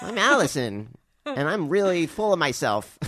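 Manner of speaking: playful hint of her voice, full of herself; Allison's voice. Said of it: "say it in my voice".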